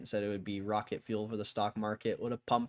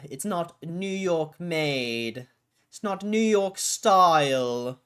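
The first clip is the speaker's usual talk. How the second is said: mock New York accent; 8 semitones higher, 7 dB louder, slower than usual. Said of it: "I can't do a New York accent".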